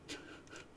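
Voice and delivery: a short breathy burst of laughter; real laugh. Real, not acted. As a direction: crack up at the joke. Reader cracks up at joke.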